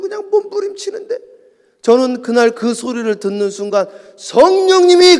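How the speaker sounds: loud, high impassioned sermon voice; fiery preaching. Retold or imitated. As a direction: preach it full force like the revival pastor. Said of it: "이렇게 설교를 해요".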